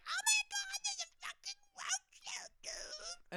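high, whispered squeal about the roach; imitating girlfriend. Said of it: "she would be like".

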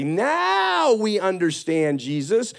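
drawn-out, swooping "now", playfully mimicked; slow, gushing imitation of eager pupils; mock dawning realization. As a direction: tease the late learners, stretching the first words. Mimics disciples finally catching on.

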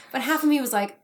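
usual speaking voice

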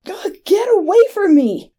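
high, desperate cry; terrified screech. A narrator's reading. Stabbed man driving off his attacker, high screech. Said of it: "he screeched".

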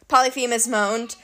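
ordinary delivery, nothing marked

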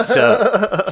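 high laughing sup; casual greeting. Retold or imitated. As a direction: toss off a laughing hello. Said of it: "and you're just".

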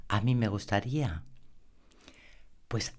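ordinary talk

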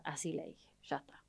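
susurrado, sin voz; cuéntalo como un secreto; susurro. Retold or imitated. Cuenta el mensaje atrevido en secreto. (susurro cómplice)

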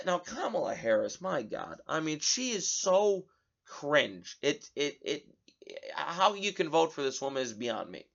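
normal talking voice

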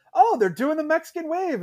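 high-pitched retold exclamation of an old guy; lightly voiced old guy. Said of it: "like, an old guy down the row from us".

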